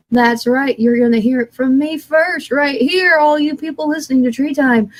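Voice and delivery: ordinary talk, nothing marked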